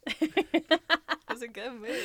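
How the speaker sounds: slow, high, laugh-filled defence. Real, not acted. Defending a random movie to co-hosts, laughing. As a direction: squeeze the words out through laughter.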